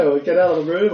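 ordinary talk, nothing marked

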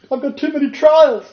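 high, loud distressed plea; tearful woman. Retold or imitated. Lady in tears complaining to her pastor, high and loud. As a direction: play her overwhelmed and pleading. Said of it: "She said".

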